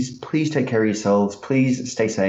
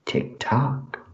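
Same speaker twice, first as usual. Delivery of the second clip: whispered; 4 dB quieter than usual. Acted. Riddler taunts time running out.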